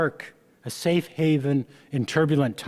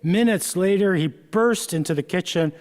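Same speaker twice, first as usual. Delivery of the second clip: usual speaking voice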